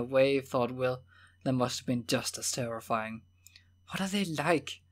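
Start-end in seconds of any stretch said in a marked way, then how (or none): 3.9-4.8 higher, timid question; small voice. A narrator's reading. Horace asks Will about the creatures, small voice. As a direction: ask it like someone shrunk by dread. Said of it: "asked in a small voice".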